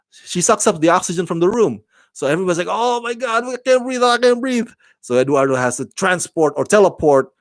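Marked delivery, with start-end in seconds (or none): 2.7-4.7 high-pitched mock panic. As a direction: imitate a crowd panicking for air, voice pitched way up. Everybody panicking as oxygen runs out.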